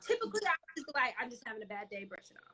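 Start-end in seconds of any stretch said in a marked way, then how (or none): 1.2-2.5 low, soft brush-off; quietly waving off a bad mood; dismissive self-excuse. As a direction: trail off softly, shrugging it away. Imagined excuse to avoid self-examination.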